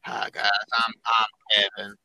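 very low, put-on dopey nerd voice; mocking low-voiced impersonation of a friend; Kevin. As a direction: drop it deep for a caricature. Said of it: "when you did Kevin's voice".

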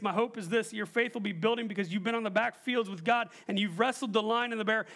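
usual speaking voice

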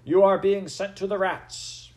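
loud, high outbursts between steadier decrees; stern angry judge. A narrator's reading. Head mouse passes angry sentence.

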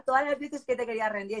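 ordinary delivery

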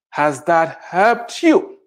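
slow, drawn-out, challenging question; slow pointed question. Acted. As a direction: stretch it out and press the point. Challenging the complainers.

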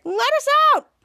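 high, loud acted plea; demanding yell. Bianca locked in the closet, yelling to Kid Danger. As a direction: yell to be let out.

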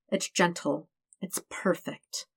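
slow, soft tender hush. A narrator's reading. Narrator lingering on the perfect kiss, soft and slow. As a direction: let it fall away softly.

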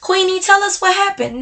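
high, eager mock audience asking what happened; imitating curious listeners. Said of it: "I know y'all probably like".

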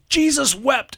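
high acted kid's exclamation; triumphant answer. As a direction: blurt it proudly, pitch way up. Boy triumphantly gives the answer.

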